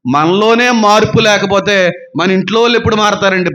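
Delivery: ordinary delivery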